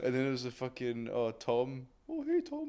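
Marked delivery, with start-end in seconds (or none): 2.1-2.7 mock greeting. Mocking a greeting.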